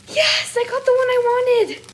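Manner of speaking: very high excited squeal; giddy, high-pitched celebration; thrilled squeal. Real, not acted. Thrilled at getting wanted figure.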